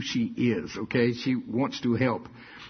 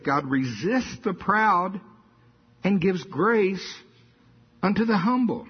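emphatic preaching; 5 semitones higher, slower than usual. Acted. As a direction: lift each word slowly. Preacher reading scripture emphatically.

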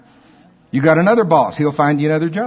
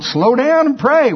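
voicing God; 11 semitones higher, 3 dB louder, slower than usual. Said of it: "God's going".

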